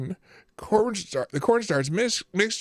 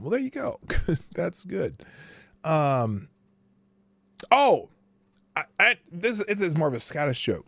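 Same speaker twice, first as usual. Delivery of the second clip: ordinary talk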